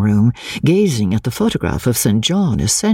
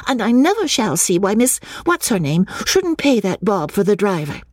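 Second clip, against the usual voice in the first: put-on protesting child; 9 semitones higher than usual. Minnie protesting, being poked.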